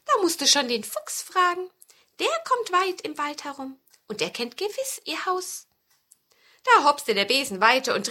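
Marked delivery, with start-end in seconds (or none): 0.1-5.7 hohes Mäusestimmchen; Maus. A narrator's reading. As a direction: als kleine Maus ganz hoch sprechen. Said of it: "sagte die Maus". (piepsige Mäusestimme)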